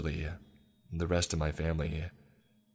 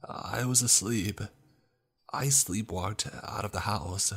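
higher, stumbling apology; desperate pleading; 4 semitones higher than usual. A narrator's reading. Brother begging his family to believe him, pleading. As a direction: plead to be believed, pitch raised.

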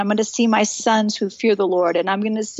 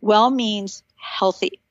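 well drawn out slowly and leaned on hard; slow down and land on well; stress on well; much slower than usual. Shown as a demonstration. Speaker to mothers on the podcast, stressing well. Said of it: "I wanna emphasize that word well".

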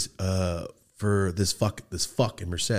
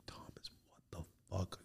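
slow stunned whisper; imitating a shocked whisper; 22 dB quieter, slower than usual. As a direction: breathe it out in disbelief, slowly. Brother stunned returning from army.

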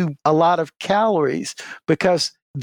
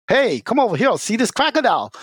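lightly acted zoo keeper, pitched up; higher-pitched mock beckoning; zoo worker calling over; 6 semitones higher than usual. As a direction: call the visitor over, higher and eager to show off. Zoo staff calling the American over.

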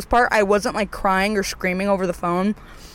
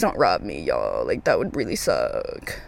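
much lower, softer plea; low pleading aside; 6 dB quieter, slower than usual. Pretend. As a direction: drop low and quiet, begging. Aside pleading with imagined burglars.

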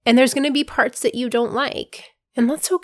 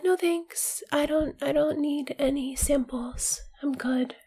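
whispering; 4 semitones higher, 8 dB quieter, slower than usual. Pretend. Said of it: "And you're like".

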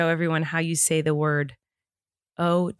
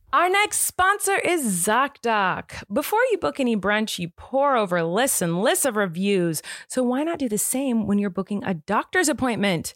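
scripted, upbeat sponsor read; podcast ad read; 4 semitones higher than usual. Acted. Host reading listeners a sponsor message, polished ad read.

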